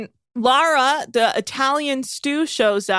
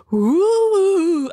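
gasp; 8 semitones higher, 6 dB louder than usual. Acted. Gasps at sound effect idea.

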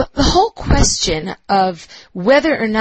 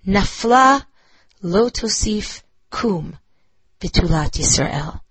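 "Nafla", pause, "lo tosif kum", pause, "betulat Yisrael" with each stress marked; slow, halting lament with clear pauses between parts; stressed syllables shown; slower than usual. Shown as a demonstration. Teacher reading Amos to class to show limping meter: slow, paused, beat-marked. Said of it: "Listen to the stressed syllables".

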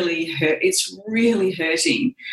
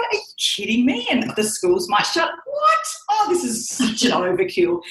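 high-pitched scoffing disbelief; quick, high protest leaping up on "what"; retold incredulity; 5 semitones higher than usual. Woman hearing schools might shut, mock incredulous. Retelling disbelief at possible closures.

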